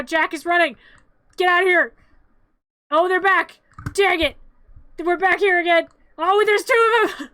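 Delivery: high, loud flustered alarm; frantic shouting. Real, not acted. Reacting as things get out of hand, frantic and loud. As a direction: shout each new trouble as it hits.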